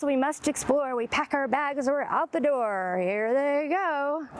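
plain reading voice

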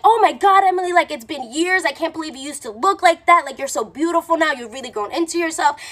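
high-pitched mock admiration; imitated gushing guy. Guy meeting Emily years after teasing her, mock gushing.

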